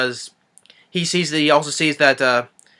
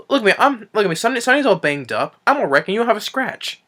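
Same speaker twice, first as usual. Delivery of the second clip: high-pitched mock indignation; lightly acted exasperated outburst; indignant complaint; 8 semitones higher than usual. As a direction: imitate an injured man griping at his unhurt friend. Retold indignant complaint from injured man.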